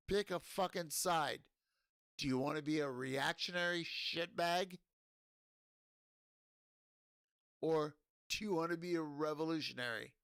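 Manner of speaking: loud and high, hammering the question at the viewers; furious demand to pick a side; angry rant. Real, not acted. Drunk rant demanding people pick sides.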